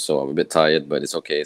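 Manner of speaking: ordinary talk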